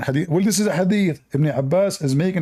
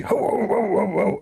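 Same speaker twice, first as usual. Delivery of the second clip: high breathy yapping bark; chihuahua yapping; 5 semitones higher than usual. Retold or imitated. Retelling a dog fight, mock chihuahua yapping. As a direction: yap like a frantic little dog. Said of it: "The Chihuahua was going crazy".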